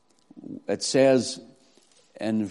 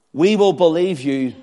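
louder, sarcastic challenge; cynical arrogance; 3 semitones higher, 6 dB louder than usual. Acted. Pharisees bargaining with Christ, cynical and arrogant. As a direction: throw it down as an arrogant condition. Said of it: "sarcasm and cynical arrogance".

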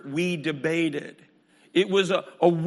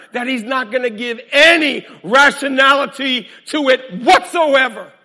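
loud preaching; 7 semitones higher, 11 dB louder than usual. Acted.

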